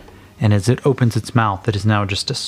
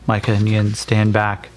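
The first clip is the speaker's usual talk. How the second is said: ordinary delivery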